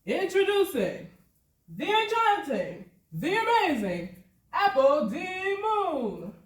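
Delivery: loud, high, grand announcer build-up; showman announcer. Retold or imitated. Announcer presenting the dancer to the ghost crowd, loud and grand. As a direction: boom it out like a ringmaster introducing the star. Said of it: "I heard him".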